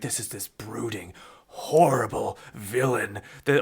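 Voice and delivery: hushed dramatic trailer voice for the villain; mock brooding narration. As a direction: build him up as menacing. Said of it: "all of a sudden like".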